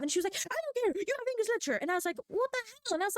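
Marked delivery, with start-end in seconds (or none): 0.4-1.8 high, curt imitation; mock dismissive teacher. Retold teacher dismissing her. 2.3-2.9 high incredulous outburst; mock disbelief. Her retold reaction.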